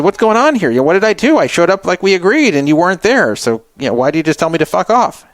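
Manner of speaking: upset confronting. Retold or imitated. Confronting her on callback.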